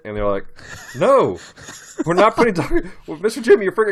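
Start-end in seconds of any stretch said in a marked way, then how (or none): 1.0-2.9 loud, high-pitched horrified refusal; hammed-up outraged little boys; imitated freaking out. Boys refusing dog poop in brownies, mock panicked protest. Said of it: "they're freaking out". 3.1-3.9 high, loud mock-panicked kids; imitated freaking out. Boys protesting to Mister Jim, mock freak-out. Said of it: "they're freaking out".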